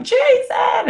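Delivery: delighted high squeal of his name; swooning. Retold or imitated. Bride swooning as husband dips her, high squeal.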